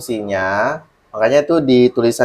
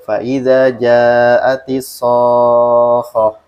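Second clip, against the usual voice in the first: slow Quranic recitation, SOKHOH held long on a level tone with a single light KH; recitazione senza rialzo; 3 dB louder, much slower than usual. Shown as a demonstration. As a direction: chant it slowly on one note, no kick into KHOH. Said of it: "kalau saya bacanya gini".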